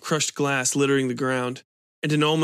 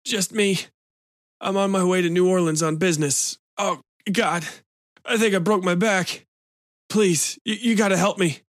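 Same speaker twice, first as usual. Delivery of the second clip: pained injured man; 5 semitones higher than usual. A narrator's reading. Injured man pleading in pain.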